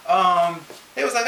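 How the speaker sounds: usual speaking voice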